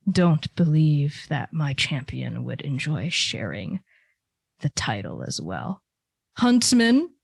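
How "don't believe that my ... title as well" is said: low, slow, hushed divine voice; Sior's voice. Acted. GM as the god Sior refusing to share, low and slow. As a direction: let it sink low and quiet like an ancient god.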